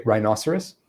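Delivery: "rhinoceros" run together in one quick go; said quickly. Shown as a demonstration. Teacher illustrating word speed, quick "rhinoceros". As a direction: say "rhinoceros" briskly, syllables joined. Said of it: "I can say it that quickly".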